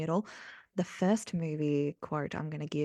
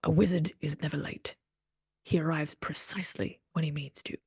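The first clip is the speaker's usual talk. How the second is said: Gandalf; 3 semitones lower than usual. Acted. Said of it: "it's gonna be Gandalf".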